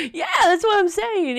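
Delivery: high, bright-eyed yes; a high, eager echo of agreement. Retold or imitated.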